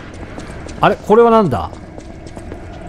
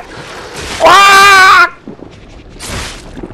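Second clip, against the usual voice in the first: long high scream; frightened scream; 14 semitones higher than usual. Real, not acted. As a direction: scream in sudden panic. Scared by enemy.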